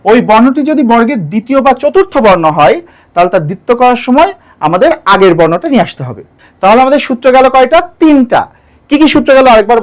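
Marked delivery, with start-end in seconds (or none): none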